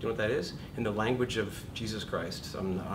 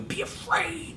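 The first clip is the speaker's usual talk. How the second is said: fear voice; slower than usual. Acted. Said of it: "the fear voice is going".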